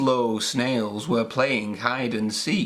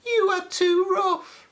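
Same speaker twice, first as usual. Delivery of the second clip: much higher little bunny cry. A narrator's reading. Bunnies turn Peppy away, very high voice. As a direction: squeal it like a bunny. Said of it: "they cried".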